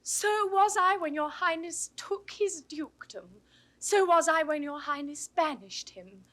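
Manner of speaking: loud, forceful comeback; indignant retort. Acted. Niece throwing the banishment back at the duke, indignant. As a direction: answer the accusation at full voice.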